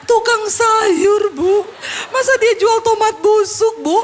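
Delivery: high, loud, drawn-out whine; mock wailing. Absurd complaint about vegetable seller, mock tearful wail. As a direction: wail a petty grievance melodramatically.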